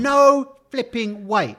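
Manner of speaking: disbelief. Retold or imitated. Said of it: "And I went".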